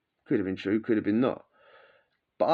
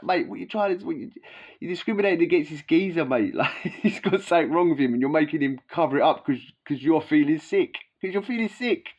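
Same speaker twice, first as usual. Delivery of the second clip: higher, half-laughing outrage; mock protest, laughing; 3 semitones higher than usual. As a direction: scold the officer through laughter. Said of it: "He's like".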